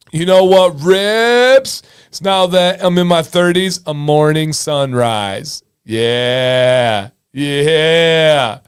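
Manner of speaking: put-on slow, drawn-out surfer-turtle dude accent; TikTok dude impression. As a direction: stretch the words like a laid-back dude. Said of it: "He reminds me of the turtle from Nemo".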